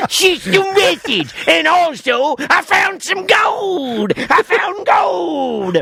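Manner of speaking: put-on high, slow, excited old miner yelling; old prospector voice. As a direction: cackle it out like a gold-crazed miner. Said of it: "old prospector voice".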